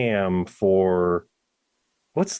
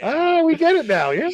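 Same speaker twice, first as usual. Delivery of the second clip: much higher, louder mock voice; suddenly understand, loudly; mock realization; 7 dB louder than usual. Mocking listeners catching on, much higher louder voice.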